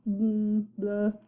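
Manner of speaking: plain reading voice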